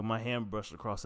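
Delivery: ordinary talk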